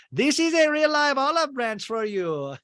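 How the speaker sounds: high, bright mock gushing; imitating Adriana. Adriana offering Larsa an olive branch, high and gushing.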